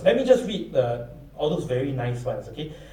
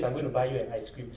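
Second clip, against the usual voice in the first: softer, quick offhand promise; mother's promise; 4 semitones lower, 9 dB quieter, faster than usual. Retold or imitated. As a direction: toss it off like a busy mum. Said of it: "your mother says".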